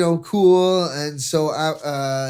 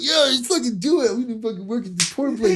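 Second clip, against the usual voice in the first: excited; 9 semitones higher than usual. Retold or imitated. Retold drunk hyped self.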